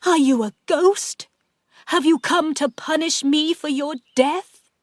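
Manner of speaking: high, loud, fearful questions; frightened questioning. A narrator's reading. Aunt asking Henry's ghost if it will punish her, frightened. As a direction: ask in rising dread.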